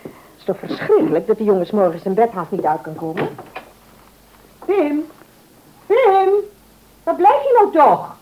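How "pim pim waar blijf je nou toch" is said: raised calling voice, higher; calling upstairs. Acted. Mother calls Pim down for school, higher raised call. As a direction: call up the stairs to a late son.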